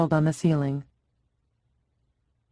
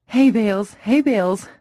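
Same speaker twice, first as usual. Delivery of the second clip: loud, higher urgent cry; 5 semitones higher, 5 dB louder than usual. Acted. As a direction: cry it out in alarm. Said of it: "Tess cried".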